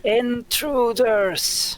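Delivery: very slow, high, loud dragon bellow; drawn-out booming cry at trespassers; dragon's shout. Acted. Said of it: "just shouts back".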